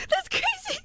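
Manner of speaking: high, wheezing laugh through the words; crying laughter. Real, not acted. Laughing hard at her own flirty line, squealing.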